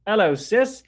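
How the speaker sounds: put-on high, loud English accent greeting. Returning Erica's hello to a sis, English accent. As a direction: greet them brightly in a mock English accent. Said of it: "says [name] with an English accent".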